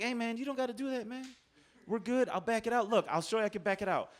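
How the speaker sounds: high, softened, coaxing voice; placating. Retold or imitated. Driver patting bystander on the chest, placating.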